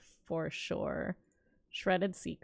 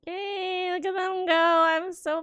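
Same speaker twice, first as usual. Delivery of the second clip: proud cheer; 9 semitones higher, 4 dB louder than usual. Real, not acted. Streamer cheering on little characters, high and proud. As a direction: cheer them on like a proud parent.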